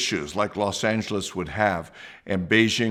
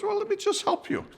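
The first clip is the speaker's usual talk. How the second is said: much higher, softer, gentle friend's voice; a high, soft, offering tone; voicing a friend; 4 dB quieter than usual. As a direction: play the kind friend, light and high. Friend insisting on helping.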